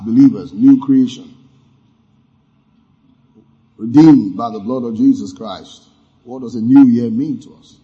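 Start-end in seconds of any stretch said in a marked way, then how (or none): none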